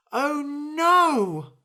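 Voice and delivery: high, loud stricken cry; anguished cry. Acted. Bird crying out on hearing its friend was eaten. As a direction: wail in horror. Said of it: "cried the bird".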